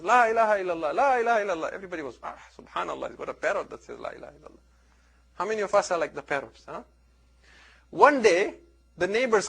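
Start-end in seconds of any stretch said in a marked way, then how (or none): 0.0-1.7 lightly voiced parrot, higher and chanted; a hint of a parrot reciting in sing-song; parrot repeating. Preacher voicing the parrot for the congregation, lightly parroted chant. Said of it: "It says". 2.2-4.6 hushed marvel, fading to a whisper; mock awe, voice dropping away; awed hush. Preacher voicing impressed visitors, softer hushed wonder.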